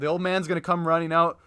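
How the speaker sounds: normal talking voice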